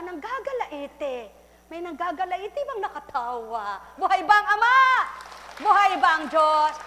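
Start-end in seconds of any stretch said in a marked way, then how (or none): 3.9-6.7 loud preacher's call, raised high; preaching shout. Real, not acted. Preacher crying out to the congregation, loud. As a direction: call out to the congregation with full force.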